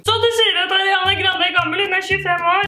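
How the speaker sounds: high, put-on voice; mock commenter. Vlogger mimicking a commenter in a high silly voice. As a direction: squeak out the commenter's accusation.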